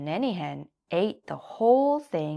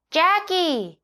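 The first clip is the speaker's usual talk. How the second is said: high, louder call across the yard; shouting; 13 semitones higher, 7 dB louder than usual. A narrator's reading. Donkey calls his friend Jackie outside. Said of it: "he shouted".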